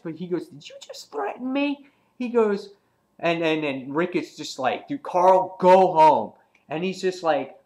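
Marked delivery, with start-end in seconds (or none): 0.5-1.9 very high, disbelieving question; high-pitched villainous disbelief; acted incredulity. Negan reacting to Carl's threat. 4.9-6.3 raised-voice command; loud, insistent retold telling-off; acted stern order. Rick ordering Carl away.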